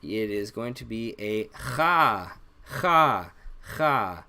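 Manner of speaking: "cha" said as a rasping throat kh, repeated; Arabic kha sound. Shown as a demonstration. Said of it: "everyone has difficulty making this sound".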